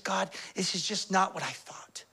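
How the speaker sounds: whispered, crushed complaint; disillusioned whisper. Acted. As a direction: breathe it out, defeated. Voicing the disillusioned believer.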